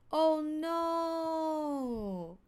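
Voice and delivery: high, stretched "no" gliding down; drawn-out exclamation. Shown as a demonstration. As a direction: hold "no" high, then let it sink. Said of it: "pronouncing the word no in different way".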